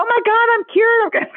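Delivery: very high, hammed-up revelation; mock amazement. Skit patient suddenly cured by the advice, mock amazed.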